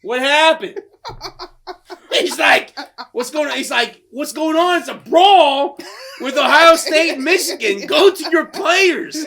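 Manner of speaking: high, loud, laughing bewildered coach; a hint of a lost head coach; mock confused coach. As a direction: play a baffled coach through laughter. Imitating confused coach Ryan Day.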